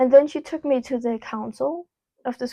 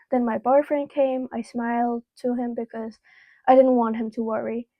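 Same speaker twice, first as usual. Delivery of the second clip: ordinary delivery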